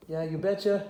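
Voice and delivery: put-on Fargo-style Minnesota accent; Minnesotan accent. Game player mimicking people who talk that way, Minnesotan accent. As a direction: imitate a folksy Minnesotan accent. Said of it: "the way this Fargo character does".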